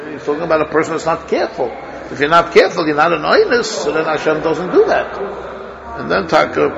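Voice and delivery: usual speaking voice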